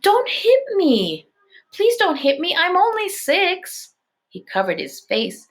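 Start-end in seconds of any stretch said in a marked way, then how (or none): 0.0-3.9 put-on high, slow wailing little-kid voice; crying little boy. A narrator's reading. Daniel crying to Elaine, high slow pleading child. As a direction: beg tearfully in a tiny high voice. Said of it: "Daniel started to cry".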